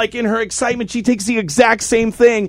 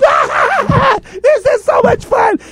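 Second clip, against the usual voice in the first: put-on giddy childlike glee; slower than usual. Partners in little mode squeal with glee. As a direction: squeal like an overjoyed little kid.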